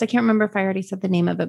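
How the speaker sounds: ordinary delivery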